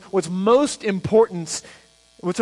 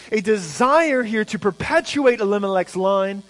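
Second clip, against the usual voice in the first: ordinary talk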